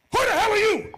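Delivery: very high, loud demand; husband confronting the man under the bed, shouting; furious shout. Retold or imitated. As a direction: roar at the intruder.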